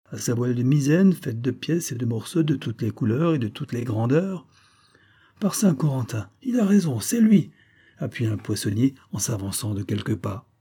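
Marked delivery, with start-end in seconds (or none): 5.4-7.5 excitation montante. A narrator's reading. Foule reconnaît le navire.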